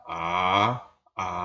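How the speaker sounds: a said low, the wrong way; a dropped low to show the mistake; low a, not allowed. Shown as a demonstration. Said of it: "jangan dibaca rendah".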